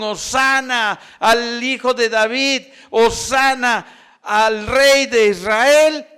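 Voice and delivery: high, loud cheer, stretched out at the end; crowd's acclaiming cry. Retold or imitated. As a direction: cry it out like a crowd hailing a king. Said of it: "que gritaron". (grito de aclamación, alto y alargado)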